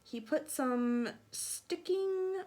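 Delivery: usual speaking voice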